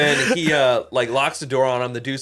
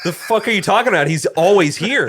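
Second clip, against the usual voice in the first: retold angry outburst; 8 semitones higher, 6 dB louder than usual. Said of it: "so I'm fucking pissed".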